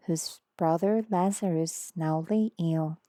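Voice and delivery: ordinary delivery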